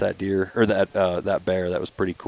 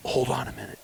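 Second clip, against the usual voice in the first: much softer, hushed aside; retold whisper; 2 semitones higher, 8 dB quieter than usual. Son readying his bow on the phone, in a mock whisper. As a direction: imitate a boy quietly pausing a call. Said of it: "he's whispering".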